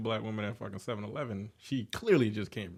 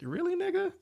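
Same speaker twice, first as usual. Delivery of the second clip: incredulous. Retold or imitated. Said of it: "I'm like".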